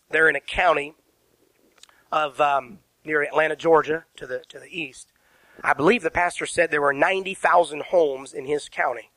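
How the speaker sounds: ordinary talk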